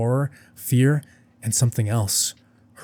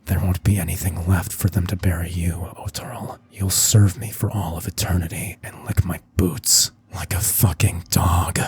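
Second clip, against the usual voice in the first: low, unvoiced menacing whisper; cold whisper; 5 semitones lower than usual. Bel's face turned cold.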